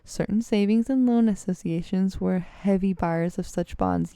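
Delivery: normal talking voice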